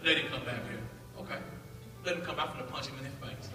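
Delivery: muttered self-hype. Retold or imitated. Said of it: "I start hyping myself".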